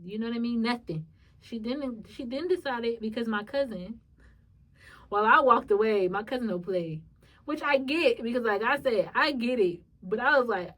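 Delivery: ordinary delivery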